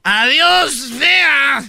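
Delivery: put-on loud slurred drunk heckling; belligerent drunk. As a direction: call it out like a rowdy drunk. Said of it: "le dijo el borracho".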